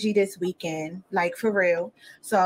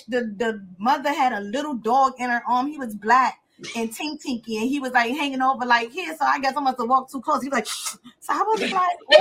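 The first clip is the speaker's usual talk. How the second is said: ordinary talk, nothing marked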